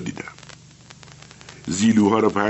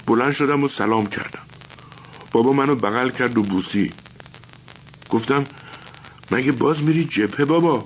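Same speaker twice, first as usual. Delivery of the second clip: put-on a lightly higher boy's voice, telling it simply; young boy remembering; 6 semitones higher than usual. Boy tells his teacher about his father leaving, lightly higher childlike voice.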